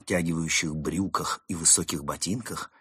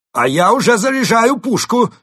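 high, very loud panicked shout; shouted bluff; 12 dB louder than usual. Acted. Mole bluffing the villains about a cannon, shouted. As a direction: yell it out at the top of your voice. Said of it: "выкрикнул Крот".

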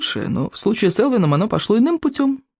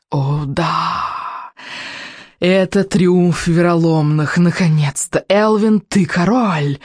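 gloating witch; much slower than usual. Acted. Witch gloats over her son.

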